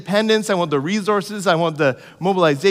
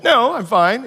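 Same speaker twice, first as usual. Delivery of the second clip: higher, louder "no, I'm fine"; defensive brush-off; 7 semitones higher, 5 dB louder than usual. Retold or imitated. Stuck up a tree, waving off his friend with a higher, louder no. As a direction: brush off the offer a bit too fast. Holding branch, refusing help.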